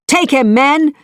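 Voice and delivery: high, loud command; shout. A narrator's reading. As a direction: order the men, loud and high. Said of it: "he shouted to the others".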